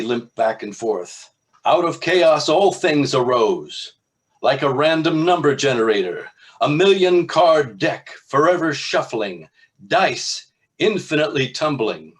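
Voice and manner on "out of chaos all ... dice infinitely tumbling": loud, higher booming proclamation; high priest bellowing to his flock; bellowing sermon. Acted. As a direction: preach it out to the whole hall. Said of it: "and began to bellow".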